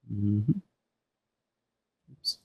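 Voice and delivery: usual speaking voice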